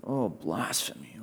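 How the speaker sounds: low, soft, slow mock horror at the word blasphemy; mock gasp. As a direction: sneer at the pretended shock.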